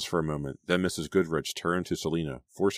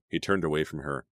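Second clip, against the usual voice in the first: plain reading voice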